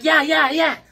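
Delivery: high, loud eager yeahs; eager yes. Acted. Fisherman eagerly agreeing to learn water walking. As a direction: rattle it off like an eager kid. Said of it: "He's like".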